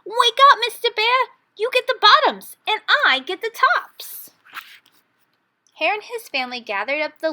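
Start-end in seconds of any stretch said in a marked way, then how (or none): 0.0-4.3 high, loud wake-up holler; gleeful rabbit calling out the harvest. A narrator's reading. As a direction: shout across the field to the bear. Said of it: "Hare called out".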